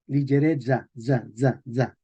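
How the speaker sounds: Ligereza as li-zhe-RE-dza, then za as dza; medieval pronunciation. Shown as a demonstration. Teacher drilling the last syllable, medieval pronunciation. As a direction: drill the voiced za at the end of Ligereza. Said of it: "en español medieval se leía".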